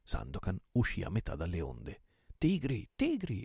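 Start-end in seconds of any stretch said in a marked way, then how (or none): 2.4-3.4 high, excited repeated cry; exclamation. A narrator's reading. Captain realises they are friends, high excited exclamation. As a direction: cry it twice, climbing higher. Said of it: "esclamò egli".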